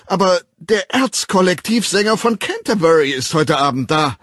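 high, loud pleading, rising; near-tearful shout. A narrator's reading. Bernard shouting his last plea through the door, high and loud. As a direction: beg one last time. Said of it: "Bernard war den Tränen nahe".

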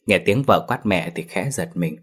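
ordinary delivery, nothing marked

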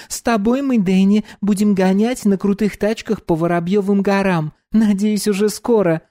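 put-on bright high young woman; high girl voice. Dominika voiced.

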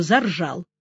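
ordinary delivery

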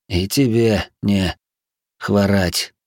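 slow, strained through pain; pained, short of breath. Acted. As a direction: speak while wincing and breathing hard. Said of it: "морщась от боли и тяжело дыша".

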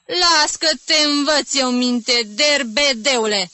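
slow, loud, high menace; angry, drawn-out warning; panting threat. A narrator's reading. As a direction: threaten slowly, drawing out each word. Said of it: "amenința gâfâind ostrogotul".

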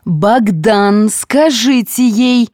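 high, whiny complaint; whining. A narrator's reading. Ilona complaining to Bogdan about a colleague, whining. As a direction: whine to him to make her stop. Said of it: "захныкала Илона".

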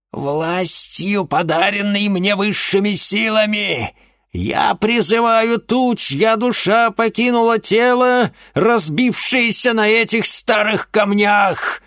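high, loud, slow summoning chant; witch incantation. Acted. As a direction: call on dark powers.

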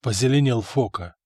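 usual speaking voice

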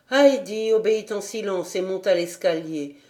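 ordinary delivery, nothing marked

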